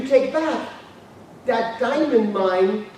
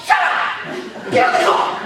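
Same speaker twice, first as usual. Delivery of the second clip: mad guy. Acted. Ordering Bill into the car, mad and urgent. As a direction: bark it like a madman. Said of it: "be like a mad guy going".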